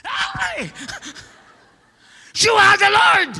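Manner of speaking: high, very loud amazed cry; astonished shout. Retold or imitated. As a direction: exclaim in awe. (gulat na gulat na sigaw)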